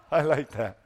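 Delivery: laughing. Real, not acted. Laughs after joke.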